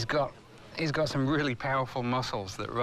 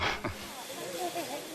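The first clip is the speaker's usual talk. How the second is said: high light laugh; 6 dB quieter than usual. Real, not acted. Joking about sedated croc.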